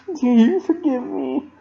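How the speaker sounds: high, pleading question; tearful plea. A narrator's reading. Dash asking Pinkie for forgiveness, tearful and high. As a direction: beg forgiveness through tears.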